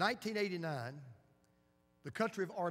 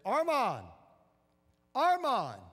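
higher and louder, calling a name into the rubble; 7 semitones higher, 9 dB louder, slower than usual. Retold or imitated. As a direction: call out for your buried son. Father calling son in rubble.

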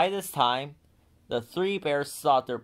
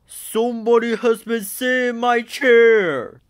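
much louder, higher booming bear; great big bear voice; 11 semitones higher, 13 dB louder than usual. A narrator's reading. Papa bear finds his chair sat in, booming. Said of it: "said the great big bear in his great big voice".

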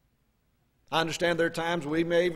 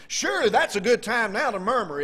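ordinary delivery

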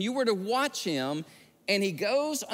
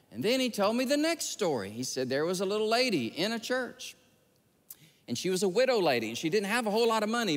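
usual speaking voice

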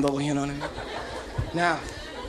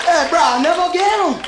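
frustrated shout; 10 semitones higher, 6 dB louder than usual. Acted.

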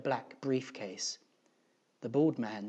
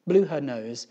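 usual speaking voice

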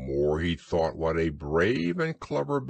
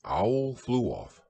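ordinary delivery, nothing marked

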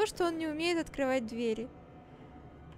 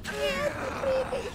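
frightened plea; 11 semitones higher, slower than usual. Real, not acted. Pleading with monster.

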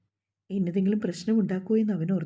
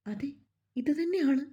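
higher-pitched happy confirmation; overjoyed; 7 semitones higher than usual. A narrator's reading. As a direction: confirm it with lifted, delighted pitch. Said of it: "അവൾക്ക് സന്തോഷത്തിന് അതിരില്ല".

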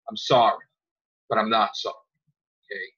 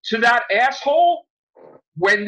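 angry call-out; 6 semitones higher, 4 dB louder than usual. Real, not acted. Calling out a critic.

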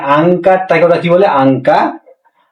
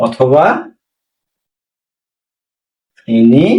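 slow and lower, long pauses between words; slow dictation; 3 semitones lower, much slower than usual. Shown as a demonstration. Teacher dictating so students can write it. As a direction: read it out for students to note down. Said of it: "এটাকে note করেন please".

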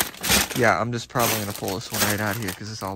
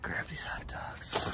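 slow, hushed whisper; 12 dB quieter, slower than usual. Real, not acted. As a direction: breathe the words out slowly under your breath. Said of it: "I don't know why I'm whispering".

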